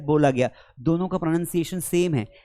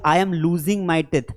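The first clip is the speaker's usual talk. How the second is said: slow example; much slower than usual. Shown as a demonstration. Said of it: "या".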